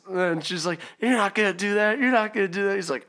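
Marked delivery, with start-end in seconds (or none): 1.0-2.8 high, insistent imitation of stepmom; defiant dare. Stepmom daring dad to call police, mock defiant. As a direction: mimic her disbelief, pitched up.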